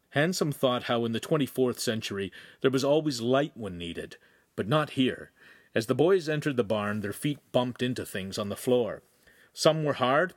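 plain reading voice

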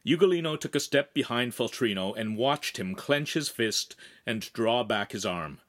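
ordinary delivery

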